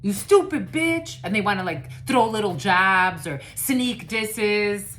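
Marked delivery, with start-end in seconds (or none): 0.0-1.2 higher, louder, slow hammed-up jab; mock insult. Reader voicing a hater's jab, mock slow yell. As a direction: drag out the insult with scorn. Acts out sneak diss.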